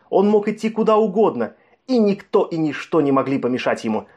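plain reading voice

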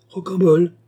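high, loud, imperious summons; grandly calling out, pitched high; majestic tone. Acted. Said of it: "d'un ton majestueux".

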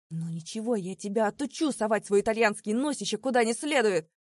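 put-on loud slurred drunken rant; drunk angry yelling. A narrator's reading. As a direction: rage at him, drunk and loud. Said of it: "проорал Вилли заплетающимся языком".

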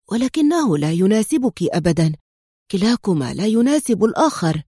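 ordinary delivery, nothing marked

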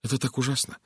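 plain reading voice

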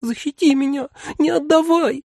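very high, tiny frightened voice; small pleading mannequin squeal; thin childlike pleading. A narrator's reading. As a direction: squeak it like a terrified little child. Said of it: "умолял тоненьким детским голоском".

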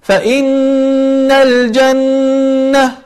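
فإن الجنة with both doubled n's held nasal; ghunnah on both. Shown as a demonstration. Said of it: "فتقول".

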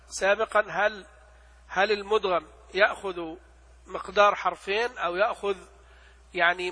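ordinary talk, nothing marked

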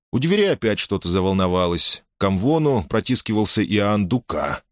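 plain reading voice

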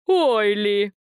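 a knowing, sly little jab. A narrator's reading. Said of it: "Хитро прищурился Световид".

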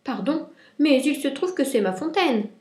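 indignant high-voiced outcry; crying out. A narrator's reading. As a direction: burst out and call it high and loud. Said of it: "sortit de sa cachette et cria".

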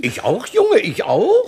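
father and son crowing over their new rank, excited. Acted. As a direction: crow with delight at being gentlemen. Shepherds gloating over new nobility.

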